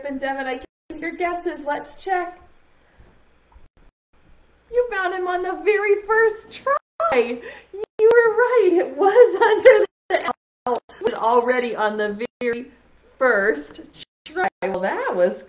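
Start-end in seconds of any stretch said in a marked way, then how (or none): 4.7-10.8 loud, high, delighted cheer with a laugh; excited praise. Real, not acted. Teacher cheering the class's right guess, loud and delighted.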